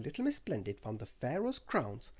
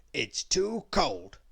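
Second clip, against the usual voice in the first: slow, louder grumpy whine; complaining; 6 dB louder, much slower than usual. Acted. Mister Grumpy complaining inside the pyramid, slow louder whine. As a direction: stretch the words into a sulky complaint. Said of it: "complained Mister Grumpy".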